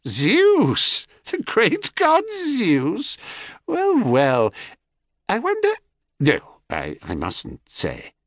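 high, slow, doubting crone; sly hag feigning doubt. Acted.

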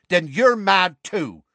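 slow, high, disgusted retort. A narrator's reading. Seamus dismissing Ron with slow disgust. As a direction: sneer it out in disgust. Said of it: "said Seamus in disgust".

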